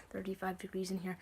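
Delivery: shivering whisper; cold, breathy whisper; gasping from cold. Real, not acted. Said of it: "it's so cold in here".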